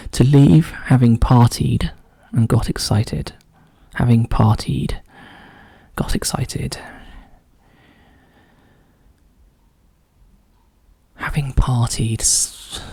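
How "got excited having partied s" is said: whispered working through the clue; whispering to self. Real, not acted. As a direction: whisper the words while puzzling.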